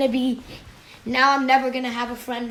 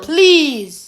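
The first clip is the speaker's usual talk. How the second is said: ordinary delivery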